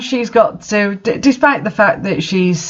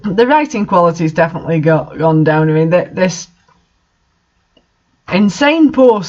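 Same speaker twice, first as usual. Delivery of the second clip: usual speaking voice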